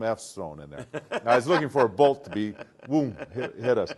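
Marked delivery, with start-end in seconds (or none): none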